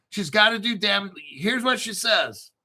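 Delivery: ordinary delivery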